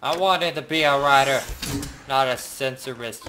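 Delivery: shouted complaint, easing slightly at the end; loud protest. Real, not acted. Player objecting to the censoring job, shouting. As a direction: holler it as a grievance.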